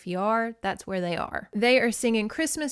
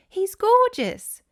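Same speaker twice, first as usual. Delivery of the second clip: high, loud, drawn-out gush; delighted exclamation; 14 semitones higher, 7 dB louder, slower than usual. Acted. Mandy exclaiming over the great aunt's cat, delighted. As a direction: squeal with adoration, stretching the words. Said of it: "Mandy exclaimed".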